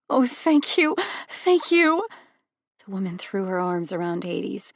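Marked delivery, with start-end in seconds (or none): 0.1-2.1 much higher, louder burst of relief; a mother thanking the god who saved her child; overjoyed thanks. A narrator's reading. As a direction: gush with tearful joy.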